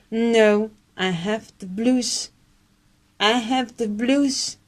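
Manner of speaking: put-on slow, downbeat British accent; glum British reply. [name] answering he feels down, mock British accent. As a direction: answer like a gloomy Englishman, slowly. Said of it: "mini English dialogue answer".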